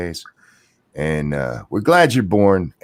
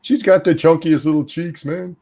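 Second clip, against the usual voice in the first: gushing; 8 semitones higher than usual. Real, not acted. Doting on baby's cheeks.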